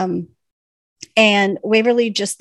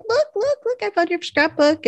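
high, gushing mock-excitement; much higher, over-eager; excited squeal; 12 semitones higher than usual. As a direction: squeal it like a giddy fan showing off a find. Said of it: "She's like".

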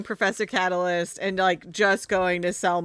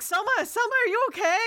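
high, urgent, worried; worried call; 13 semitones higher, much faster than usual. Acted. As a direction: call out her name in alarm. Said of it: "he's like".

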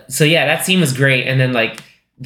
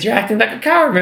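higher mock accusation; accusing; 9 semitones higher, faster than usual. [name] teasing Lionel for hiding from his wife, higher mock jab. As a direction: tease him as a coward in a lifted voice. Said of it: "He's like".

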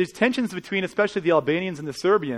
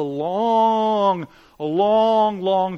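drawn-out long; 2 semitones higher, 3 dB louder, much slower than usual. Real, not acted. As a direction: stretch each long slowly. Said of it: "stretched repeated long".

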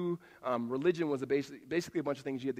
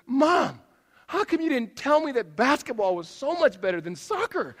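put-on high, loud kid's complaint to mom; much higher, loud childish protest; excited kid; 8 semitones higher, 7 dB louder than usual. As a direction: son bursting in after his first game. Son voiced complaining to mom.

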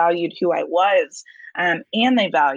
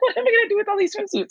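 high, fast retold fretting; mock-bewildered, pitched way up; mock dismay; much faster than usual. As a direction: rush it out in a high, helpless voice. Retold dismay at unwanted swimsuits.

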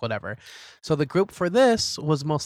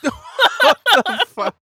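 high loud laughing shriek; 10 dB louder, much slower than usual. Real, not acted. Laughing disbelief.

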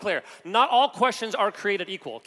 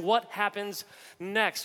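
slow suspense; much slower than usual. Acted. At the cliff in E T.

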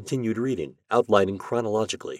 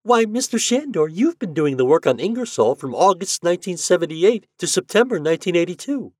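put-on a much higher young woman, a touch puzzled; puzzled clerk girl; 10 semitones higher, 6 dB louder than usual. A narrator's reading. Clerk tells Shandor he did the work himself, puzzled. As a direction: sound surprised he doesn't know his own work. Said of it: "her face was puzzled".

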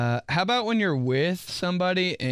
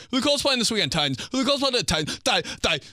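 imitating dad; 8 semitones higher, faster than usual. Mocking dad's weekly line.

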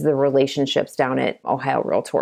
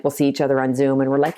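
ordinary delivery

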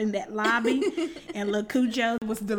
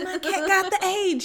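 my cat got the aids, said louder and higher; loud proud imitation; 9 semitones higher, 7 dB louder than usual. Cat owner telling the lobby, loud proud announcement. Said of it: "Yeah, you know".

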